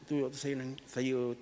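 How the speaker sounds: ordinary delivery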